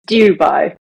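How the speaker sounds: "Dubai" with "Dew" like morning dew, "Dyoo-bai"; a posh British "Dubai", "Du" as "dew". Shown as a demonstration. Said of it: "the posh way of saying it is".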